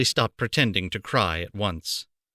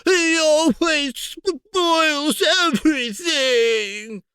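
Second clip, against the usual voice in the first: very high, slow hammed-up crying; fake sobbing; 4 dB louder, much slower than usual. A narrator's reading. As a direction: bawl it like a spoiled child. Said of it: "Dudley yelled between huge pretend sobs".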